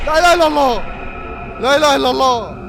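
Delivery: loud, high cries of alarm; frightened shouting. Real, not acted. Scared by game monster. (زعيق من الخوف)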